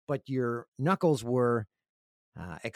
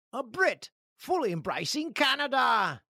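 lightly put-on British accent, high and slow; a playful stab at a British accent; hint of a British accent, drawn out; mock British accent; 10 semitones higher, slower than usual. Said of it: "that was a pretty good accent".

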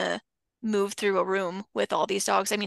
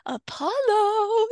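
much higher, slow and drawn-out sweet call; name like a treat; 12 semitones higher, 5 dB louder, much slower than usual. Shown as a demonstration. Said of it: "like their name is a treat".

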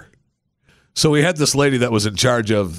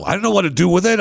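ordinary talk

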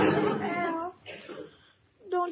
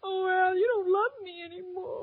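comic crying, wailing softly; tearful whimper; 3 dB quieter than usual. Acted. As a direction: whine it through fake tears. Irma thinks Al stopped loving her.

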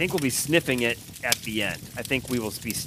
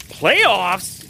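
excited announcement; 8 semitones higher, 9 dB louder than usual. Acted. Game show style reveal.

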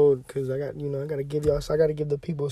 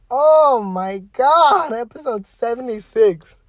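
higher and louder, drawn out; amazed; 8 semitones higher, 8 dB louder, slower than usual. Real, not acted. Podcaster amazed at reaching his episode count, stunned. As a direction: marvel at the number.

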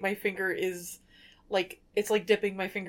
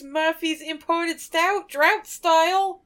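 put-on slow, drawn-out New York accent; 6 semitones higher, 5 dB louder, slower than usual. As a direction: stretch each word, New York accent. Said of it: "say it with a New York accent".

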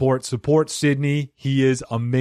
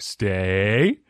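dog command; 5 semitones lower, much slower than usual. Pretend. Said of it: "giving you commands like a dog".

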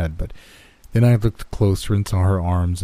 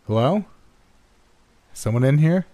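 higher-pitched, questioning call; tentative calling out; 8 semitones higher than usual. Acted. Narrator calls into a dark grotto, tentative and higher. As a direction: call out uncertainly, voice lifting.

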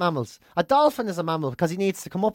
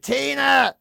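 high, drawn-out call for help; imitated invalid's cry, raised pitch; sick mother calling; 7 semitones higher, 4 dB louder than usual. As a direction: call upstairs-bound "Tina!" as the ailing mother. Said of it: "she'd ask Tina to help her".

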